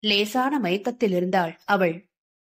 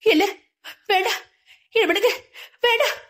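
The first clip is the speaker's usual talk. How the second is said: much higher panicked pleas with a sob-like catch; screaming no; 11 semitones higher, faster than usual. A narrator's reading. Sindhu thrashing in her sleep, crying out high in panic. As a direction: cry out in terror, pitch climbing. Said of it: "உடலை முறுக்கிக் கொண்டு கத்த".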